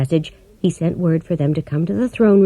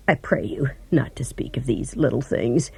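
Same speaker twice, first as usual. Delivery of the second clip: much softer, lower pleading whisper; 6 semitones lower, 7 dB quieter than usual. A narrator's reading. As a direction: beg them quietly to drop it. Wizard pleading in secret.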